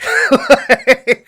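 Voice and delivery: high loud laugh; burst of laughter. Real, not acted. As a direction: crack up out loud. Laughing at the movie line.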